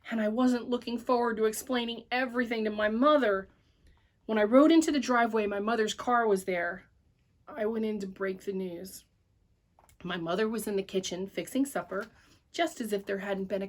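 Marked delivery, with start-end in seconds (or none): none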